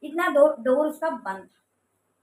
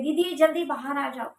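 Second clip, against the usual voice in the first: usual speaking voice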